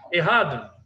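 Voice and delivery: ordinary talk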